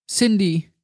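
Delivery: put-on high, pleading child voice; pained little girl. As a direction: beg like a tortured girl. The girl says her name. (gequälte Kinderstimme, flehend)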